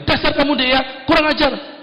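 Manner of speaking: hammed-up husband cursing his wife; mock angry husband. Husband snaps at his wife, mock angry.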